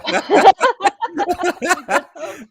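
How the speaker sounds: high loud burst of laughter. Real, not acted. Reacting to the host's joke, bursting into laughter.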